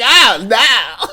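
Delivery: mock horror over a small spill; exaggerated "oh, no" impersonating a friend; overreacting friend. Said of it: "he was like".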